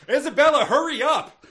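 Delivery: loud, high-pitched calling out; sudden hammed-up summons; impatient shout. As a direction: yell across the room to hurry her. Interrupts Isabella's long whispering.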